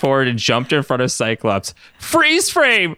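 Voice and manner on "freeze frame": shouted command. A narrator's reading. Ryan stopping the robots with his power, shouting. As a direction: yell it out as a power move. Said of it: "Ryan shouted".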